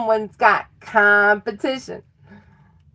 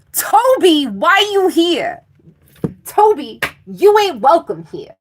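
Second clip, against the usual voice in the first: loud, high, hammed-up telling-off; mock scolding; 7 semitones higher, 6 dB louder than usual. Reader orders Toby out of the reading, mock scolding. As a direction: playfully chew out an intruder.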